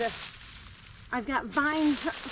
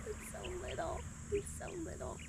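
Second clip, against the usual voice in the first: high, much softer cooing over something tiny; 9 semitones higher, 14 dB quieter than usual. Real, not acted. Gardener cooing to viewers over a tiny potato, high and soft.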